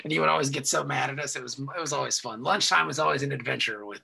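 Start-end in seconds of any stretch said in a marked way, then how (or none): none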